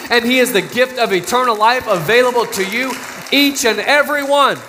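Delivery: fervent pulpit climax, building in pitch; preaching at full force. Real, not acted. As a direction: preach it out to the whole room. Pastor rises into full preaching.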